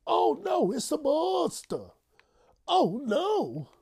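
high, loud hammed-up fright; mock panic. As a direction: shriek in fake terror.

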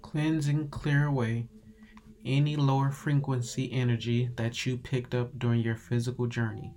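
unhurried and gentle, easing them into calm; calm meditative tone. Acted. Leads a guided meditation.

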